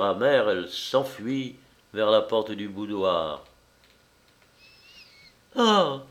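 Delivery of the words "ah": exclamation. A narrator's reading. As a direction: cry out "Ah" on a high note. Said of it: "s'écria-t-elle". (exclamation aiguë, sans forcer)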